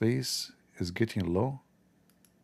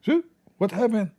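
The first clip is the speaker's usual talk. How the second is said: higher, louder startled mom; shocked mom; 13 semitones higher, 5 dB louder than usual. Retold or imitated. Mother gasping at her son at the door, startled. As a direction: play the stunned mother at the door.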